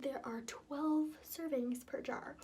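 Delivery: slow breathy whisper; hushed and unhurried; whispery voice. Real, not acted. Holds whisper in bath.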